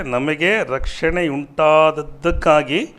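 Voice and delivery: loud, slow, drawn-out cry of praise; acted acclaiming shout. Preacher re-enacts heaven's multitude crying out, loud acclamation. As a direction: proclaim it slowly to a vast crowd, building. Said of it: "ಹೆಂಗ್ ಕೂಗಿದ್ರಂತೆ?".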